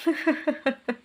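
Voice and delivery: high, rippling character laugh; acted cackling laugh. A narrator's reading. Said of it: "ಜೋರಾಗಿ ಪಕಪಕನೆ ನಕ್ಕರು".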